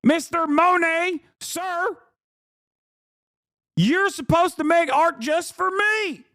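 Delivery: high-pitched mock indignant customer; put-on high voice of an outraged art critic; entitled complainer. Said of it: "can you imagine being like".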